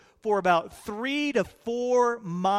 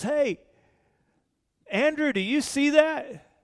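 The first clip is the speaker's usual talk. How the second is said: higher, startled call across the boat; a squinting fisherman calling to his brother; Peter calling out; 6 semitones higher than usual. Retold or imitated. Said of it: "looks again and says".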